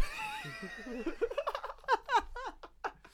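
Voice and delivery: long, high peal of laughter; helpless high giggling that dies down; real laughing fit. Real, not acted. Co-host cracks up at flirty banter, real laughing fit.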